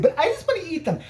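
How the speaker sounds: high, whiny imitation of a friend; exaggerated high-voiced protest; mock pleading. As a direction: plead like a kid denied candy. Acting out Steve pleading to eat candy.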